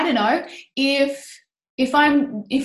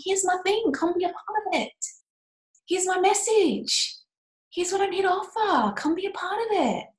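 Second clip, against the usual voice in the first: higher and softer, a sing-song invite; light inviting voice; 9 semitones higher, 3 dB quieter than usual. Retold or imitated. Host imitating successful sellers inviting people, high and soft. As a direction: float it up high like a friendly call.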